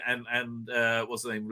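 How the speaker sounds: usual speaking voice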